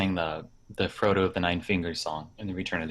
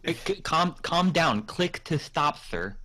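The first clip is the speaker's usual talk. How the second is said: higher-pitched, lightly acted calming helper; interviewer guy; 6 semitones higher, slower than usual. Guy steadies Tolkien on his horse, lightly acted helper voice. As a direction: talk the old author down politely. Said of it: "I'll be the guy".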